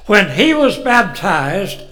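slow, building to a loud preaching cry; fervent scripture reading. Acted. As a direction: read the verse like it lifts the roof. Reads scripture with rising force.

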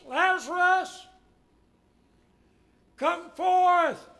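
loud, high, drawn-out command; loud cry. Acted. Preacher acting Jesus calling Lazarus from the tomb, loud cry. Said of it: "cried out in a loud voice".

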